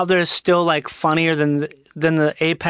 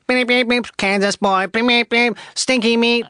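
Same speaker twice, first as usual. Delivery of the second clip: higher, chanting robot voice with beeps; 5 semitones higher than usual. Pretend. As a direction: beep it out like a poetry robot. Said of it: "So the robot goes".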